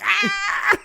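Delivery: high-pitched flying yell; comic scream while hurtling along; high mock scream. Batman flying through streets in his suit, screaming.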